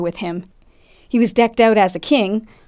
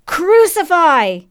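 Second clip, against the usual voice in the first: high, drawn-out mob cry; slow, high roar of condemnation; louder crowd roar; 8 semitones higher, 4 dB louder, much slower than usual. A narrator's reading. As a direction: belt it out, long and falling. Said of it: "It was louder this time".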